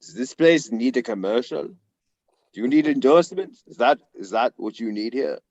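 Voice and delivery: snarky imitation of a German coach; Jurgen Klopp. Reporter retells coach's comeback to a press question, mock snarky. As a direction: put on a coach's accent, needling the questioner. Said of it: "he was all snarky".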